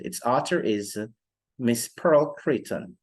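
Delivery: ordinary talk, nothing marked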